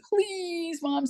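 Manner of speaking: high tearful plea; imitating crying children. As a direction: beg in a small, high child's wail. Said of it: "and they're crying".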